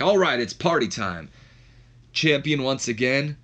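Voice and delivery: high, drawn-out party cheer; celebrating. Retold or imitated. Champion ready to celebrate after his win, excited. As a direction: hype up a victory in a retold voice. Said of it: "He's ready to celebrate".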